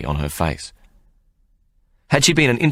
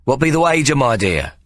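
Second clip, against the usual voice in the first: higher sly question; Ikey, sly showman; 7 semitones higher than usual. Acted. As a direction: tease her with the question. Ikey asks Sally.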